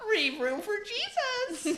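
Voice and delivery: high, slow, laughing through the words. Real, not acted. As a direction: crack up as you say it. Joke, laughing hard.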